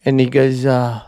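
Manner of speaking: usual speaking voice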